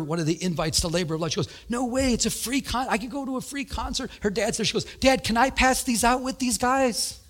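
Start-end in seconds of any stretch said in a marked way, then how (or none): 1.7-4.1 put-on bright, higher excited kid voice; a young girl's delighted gush. Excited girl quoted in story. 5.0-7.2 put-on higher, pleading kid voice; girl eagerly begging her dad.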